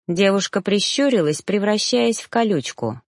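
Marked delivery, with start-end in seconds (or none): none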